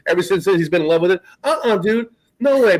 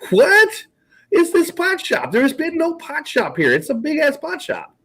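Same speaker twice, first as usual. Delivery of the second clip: retold bafflement; 10 semitones higher than usual. Telling a friend about the mystery pot shop, high bewildered outcry. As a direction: replay the shock of a shop appearing from nowhere. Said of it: "And I go".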